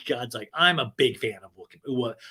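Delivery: usual speaking voice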